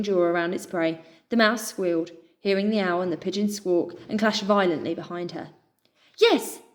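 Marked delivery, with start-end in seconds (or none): 6.2-6.6 high, loud joyful shout; triumphant little yes; cry of joy. A narrator's reading. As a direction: cheer it out. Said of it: "Pip cried".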